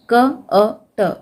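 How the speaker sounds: each sound said apart, slowly: क, अ, ट; sounding out letters. Shown as a demonstration. Teacher sounding out a word for pupils, slow and separated. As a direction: break कट into क अ ट. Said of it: "इसको कैसे पढ़ेंगे?".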